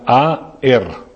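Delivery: "aer" stretched out very slowly; "aer" said slowly in two syllables; slow Greek root. Shown as a demonstration. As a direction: draw out "aer" as a-er. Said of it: "pero es aer".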